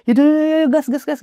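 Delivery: high, excited urging to get going; eager hurry. Retold or imitated. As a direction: pitch it way up, itching to leave. Friend eager to go.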